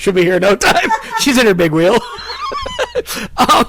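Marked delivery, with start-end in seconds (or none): 2.0-3.3 squealing burst of laughter; high shrieking laugh. Real, not acted. Joke about sister's big wheel.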